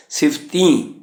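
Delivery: ਸਿਫਤੀ ending in nasal ee; nasalised final vowel. Shown as a demonstration. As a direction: say the last ee of ਸਿਫਤੀ through the nose. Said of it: "ਸਿਫਤੀ ਦੀ ਬਿਹਾਰੀ ਉੱਪਰ ਬਿੰਦੀ ਦਾ ਉਚਾਰਨ". (ਸਿਫਤੀ ਦੀ ਬਿਹਾਰੀ 'ਤੇ ਬਿੰਦੀ ਵਾਲੀ ਨਾਸਕੀ ਆਵਾਜ਼)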